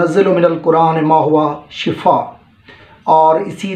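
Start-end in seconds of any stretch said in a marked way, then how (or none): 0.0-2.4 Quran recitation. Shown as a demonstration.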